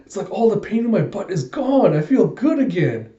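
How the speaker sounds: high, elated exclamation; delighted relief. Acted. Woman finds her pain gone after drinking, elated. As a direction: marvel at feeling well again.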